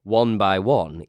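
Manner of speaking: ordinary delivery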